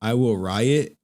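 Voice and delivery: normal talking voice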